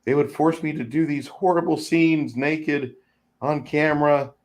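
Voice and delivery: high, tearful imitation; mock crying. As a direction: pretend to break down in tears. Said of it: "she starts breaking down on stage".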